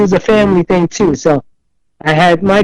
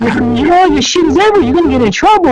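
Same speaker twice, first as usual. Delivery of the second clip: put-on kids warning; 8 semitones higher, much faster than usual. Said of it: "all the kids were like".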